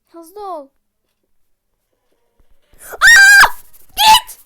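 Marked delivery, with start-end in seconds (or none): 2.9-4.4 sudden high terrified scream; screaming at a jump scare; real scream. Real, not acted. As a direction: shriek in real fright. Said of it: "fena bağırdım".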